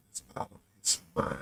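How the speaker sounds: whispered thought; breathy whispered aside; inner thought. Retold or imitated. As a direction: hush it like a private thought. Thinking about granddaddy's advice.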